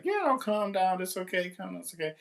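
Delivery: high, soft cooing teacher voice; gentle high-pitched reassurance, lightly parodied; mock soothing. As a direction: imitate teachers hushing an upset child. Teachers soothing kid in hugging circle.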